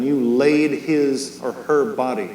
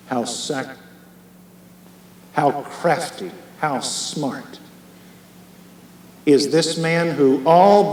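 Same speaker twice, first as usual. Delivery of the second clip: fervent prayer. Real, not acted. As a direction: lead the church in a stirring prayer. Leads the congregation in prayer.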